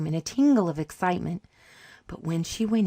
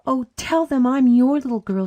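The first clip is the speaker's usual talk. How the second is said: put-on loud, high-pitched childish plea; little girl urgently imploring; begging; 8 semitones higher, 8 dB louder than usual. A narrator's reading. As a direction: beg desperately, voice pitched right up. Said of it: "she begged".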